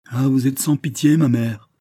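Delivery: high, loud cry of hurt; anguished protest. A narrator's reading. Daughter reproaches her pitiless mother, anguished. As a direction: cry out against her cruelty.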